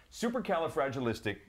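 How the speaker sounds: rattled off fast; fast supercalifragilistic. Shown as a demonstration. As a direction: zip through the word fast. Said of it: "So, so here we go".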